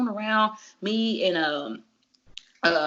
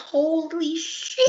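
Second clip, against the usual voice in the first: mock surprise; 11 semitones higher, much slower than usual. Friend's surprised reaction voiced.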